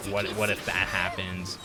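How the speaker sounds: ordinary talk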